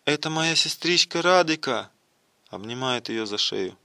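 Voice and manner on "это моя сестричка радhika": raised-pitch, emotional exclamation; thrilled recognition, lightly performed; trembling with excitement. A narrator's reading. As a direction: announce a sister with acted agitation. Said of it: "дрожа от волнения".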